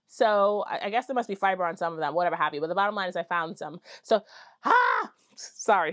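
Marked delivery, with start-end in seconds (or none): 4.6-5.1 short high shriek of excitement; excited scream. Real, not acted. Vlogger showing off found bobbins, excited scream. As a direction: let out a sudden little squeal. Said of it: "sorry for screaming".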